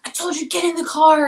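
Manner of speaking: high, loud mock yell; imitated yell. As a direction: shout it like the angry boyfriend. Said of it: "yelling at me".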